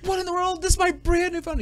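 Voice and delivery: high, indignant mock shriek; hammed-up disbelief from Pam; shocked outrage. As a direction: react like your new phone just got thrown. Pam upset about thrown phone.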